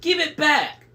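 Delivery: high, loud screech; yelled demand. A narrator's reading. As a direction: shriek the demand at your sister. Sister demands the eye back.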